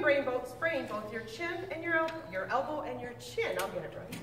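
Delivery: ordinary delivery, nothing marked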